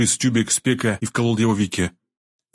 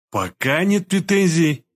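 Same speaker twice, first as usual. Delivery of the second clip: slow, drawn-out sceptical repeat, higher; drawled echo; 7 semitones higher, slower than usual. A narrator's reading. Doctor echoes Bashnya's words back, drawling them slowly. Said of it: "протянул я".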